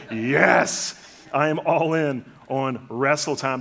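loud, eager and emphatic; all-in answer. Retold or imitated. Dad loudly accepting his son's wrestling challenge. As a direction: commit to the game with full energy.

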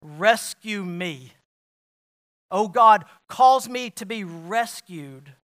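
loud, slow pleading cry to God. Retold or imitated. Preacher voices the psalmist crying out to God, loud and pleading. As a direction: cry out the psalm as a desperate plea. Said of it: "The psalmist cries out".

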